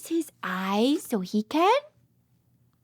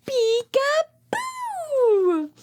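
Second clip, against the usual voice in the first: high, slow, thrilled "Peekaboo!"; delighted high peekaboo; excited peekaboo; 9 semitones higher, 4 dB louder, much slower than usual. Acted. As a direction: stretch out the big reveal for the babies. Peekaboo reveal to toddlers.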